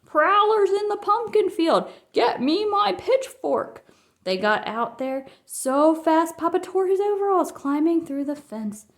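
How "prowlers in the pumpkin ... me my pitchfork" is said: high, loud farmer's alarm; shout. A narrator's reading. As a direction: yell out at the intruders in the dark. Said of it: "they heard Ben shout".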